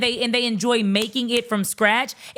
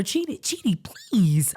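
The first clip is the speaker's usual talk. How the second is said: pleading; slower than usual. Acted. Speaking as if to the blind brother, pleading. Acts out a plea to Chidi.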